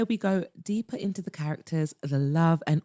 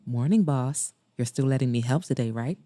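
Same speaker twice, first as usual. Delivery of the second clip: lower-pitched, easy man's voice; Maxwell; 7 semitones lower than usual. Acted. Maxwell texts the club's boss, lower character voice. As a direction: drop the pitch for his text.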